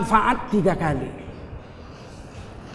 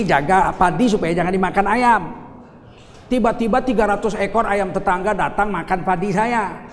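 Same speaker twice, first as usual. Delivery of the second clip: ordinary talk, nothing marked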